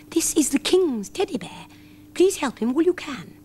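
a hint of a high mouse whisper-chain; mouse message. A narrator's reading. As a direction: pass it along like a mouse. Mice pass the word.

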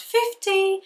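three pound fifty sung way up high and slow; high price voice. Shown as a demonstration. Mocking her own habit of saying prices high. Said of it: "my voice goes up when I say the price".

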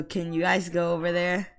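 ordinary talk, nothing marked

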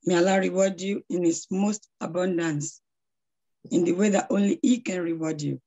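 ordinary talk, nothing marked

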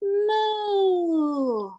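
a gasp, then a loud, high mooing cow. Pretend. Parent answering own question to baby with loud high moo. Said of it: "What does a cow say?".

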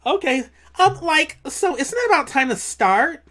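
put-on high, airy Valley Girl lilt; bubbly Valley Girl. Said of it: "I gotta do a Valley Girl voice".

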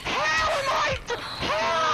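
high, loud cry of disbelief; very high-pitched gamer outburst; outraged shout. Real, not acted. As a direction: yell in protest at the game. Outcry at dying in the game.

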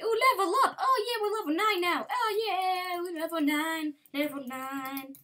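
high, loud triumphant cheer; level up celebration. Real, not acted. Player hitting level nine, cheering high and loud. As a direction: celebrate like you just won.

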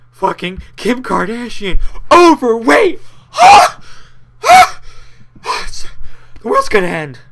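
high, loud, hammed-up hysteria; mock panic. Mocking celebrity gossip news, overblown breathless panic. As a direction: gasp through a fake end-of-the-world panic.

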